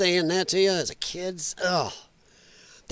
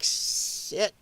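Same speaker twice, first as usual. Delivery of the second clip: breathy whispered shit; whispered dismay at looking old; whispered curse; 6 dB quieter than usual. Retold or imitated. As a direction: mutter it under your breath, caught out. Private reaction to being uncarded.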